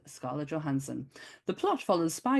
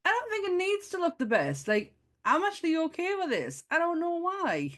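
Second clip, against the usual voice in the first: sheepish high; 11 semitones higher than usual. Retold or imitated. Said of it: "I'm like".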